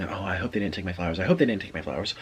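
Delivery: low, soft, hurried fretting; worried muttering. Retold or imitated. Friend fretting on the walk back to the car, worried muttering. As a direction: fret quietly under your breath. Retelling friend's anxious wish.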